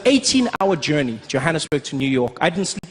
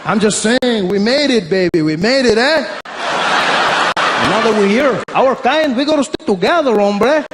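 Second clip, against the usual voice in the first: higher, louder Mexican buddy; louder, higher Mexican-accented buddy voice; 6 semitones higher, 3 dB louder than usual. Retold or imitated. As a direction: claim him as one of his own kind. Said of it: "He said".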